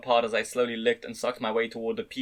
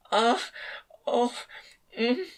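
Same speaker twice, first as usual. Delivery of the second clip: high, soft little moans; 11 semitones higher, much slower than usual. A narrator's reading. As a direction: let each sound leak out. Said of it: "a soft moan would leak out".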